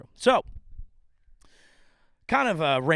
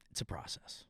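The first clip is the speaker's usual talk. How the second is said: hushed, breathy whisper of advice; 6 semitones lower, 13 dB quieter than usual. Retold or imitated. Brother soothing dad in hospital, whispered. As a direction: lean in and whisper it gently.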